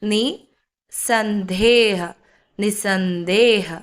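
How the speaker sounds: നിസന്ദേഹ drawn out slowly, no "ha" after "ni"; silent visarga. Shown as a demonstration. Teacher re-reading for learners, നിസന്ദേഹ slow with silent visarga. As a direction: stretch നിസന്ദേഹ as "ni, san-deeh". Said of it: "അതുപോലെ തന്നെ ഇവിടെയും silent ആണ്".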